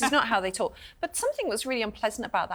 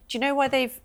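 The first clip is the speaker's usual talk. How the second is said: usual speaking voice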